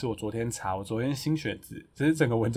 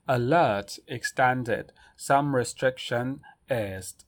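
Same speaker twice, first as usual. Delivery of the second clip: slow headline read; slower than usual. Shown as a demonstration. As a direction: slowly read news headline. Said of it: "然后再来下一个新闻".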